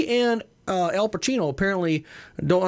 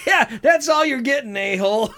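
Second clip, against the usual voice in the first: higher, louder laughing yell; laughing shout; 7 semitones higher, 5 dB louder than usual. Pretend. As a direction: laugh while yelling the insult.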